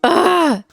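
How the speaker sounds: a high, exasperated "Ugh!"; frustrated groan. Real, not acted. Overwhelmed reaction to book twist.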